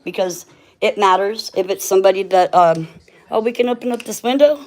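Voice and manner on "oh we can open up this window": quick, high and delighted; bursting with surprise; excited. Real, not acted. Delighted discovery.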